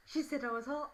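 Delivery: put-on tattling boy voice; taunting schoolboy. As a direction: play a boy loudly telling on her. Mimics boy in front taunting.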